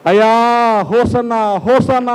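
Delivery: high, drawn-out Hosanna cry. Acted. Preacher cries Hosanna to the Lord, crying out. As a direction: call it out high, stretching the words. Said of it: "అని కేకలేయడానికి".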